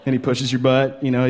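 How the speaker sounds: ordinary talk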